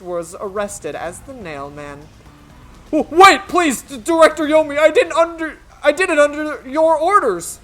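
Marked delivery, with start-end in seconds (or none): 2.9-7.6 loud, high, desperate begging; loud high plea to the director; panicked pleading. Acted. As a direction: beg for your life. Accused man pleads with director.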